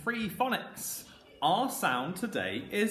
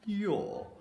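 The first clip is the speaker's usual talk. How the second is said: Queen's English your; 6 semitones higher than usual. Shown as a demonstration. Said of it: "say it a bit like the Queen would".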